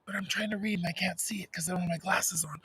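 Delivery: hushed, unvoiced apology; real whisper, close to the microphone. Real, not acted. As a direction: whisper your excuse after being told off. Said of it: "I'll whisper".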